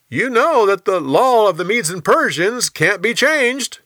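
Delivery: high, loud reminder to a king; insistent officials. Acted.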